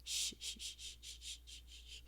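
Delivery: hushed, fading shh sounds; calm them down with a gentle hush; soft shushing. Acted. Soothing a frightened partner.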